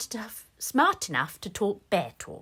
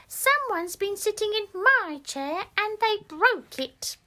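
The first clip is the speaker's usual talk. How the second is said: much higher, tiny cub voice; Baby Bear; 11 semitones higher than usual. Acted. Baby Bear finds his chair broken, high little voice. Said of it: "Then Baby Bear said".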